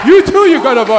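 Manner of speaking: loud, urgent call to the crowd; rousing preaching. Real, not acted. Preacher telling each person they have a voice, rousing shout. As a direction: proclaim it forcefully at each listener.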